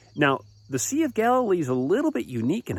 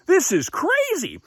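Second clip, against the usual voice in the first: very high, drawn-out exclamation; amazed disbelief; 3 dB louder, slower than usual. Acted. Fishermen overwhelmed by a huge catch, amazed high cry. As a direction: stretch it out high in acted astonishment.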